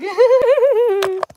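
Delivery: loud squealing laugh; high burst of laughter. Real, not acted. Laughing at her own pose.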